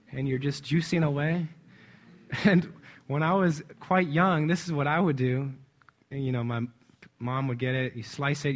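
normal talking voice

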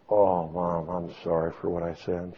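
low, soft, sheepish apology; retold apology. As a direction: re-enact quietly making up with a mother-in-law. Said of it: "Everybody was sorry".

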